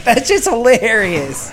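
high, drawn-out mock amusement; amused reaction. Injured man reacting to older guy's story, high amused exclamation. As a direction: stretch it out in a high voice.

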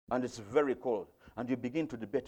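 usual speaking voice

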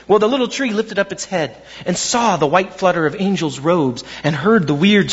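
usual speaking voice

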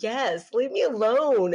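high, slow, drawn-out mock exasperation; exasperated retort. Crying mom to her staring little son, exasperated.

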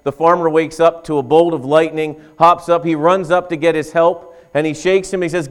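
ordinary talk

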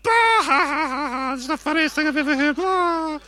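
hammed-up shrieking laugh imitating her; mocking laughter. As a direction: mimic a friend laughing her head off. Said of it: "she was like".

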